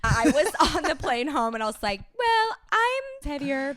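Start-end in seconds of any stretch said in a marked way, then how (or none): 2.2-3.8 high, drawn-out mock proclamation; hammed-up heavy announcement. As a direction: belt it slowly, way up high. Mock greeting after holiday weight gain.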